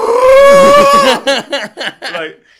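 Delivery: very loud, high-pitched imitated shriek; hysterical wail. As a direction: let out a long mock-hysterical scream. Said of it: "I remember just being hysterical".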